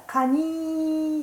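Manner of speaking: カニー with a long, high held "ee"; stretched final syllable. Shown as a demonstration. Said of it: "ちょっと二が伸ばすんですよ". (カニーの「ニー」を高く長く伸ばす)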